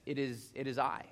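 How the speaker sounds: calm them like a steady friend; reassuring Jesus. Retold or imitated. Jesus speaking to terrified disciples, low soft reassurance. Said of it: "and says".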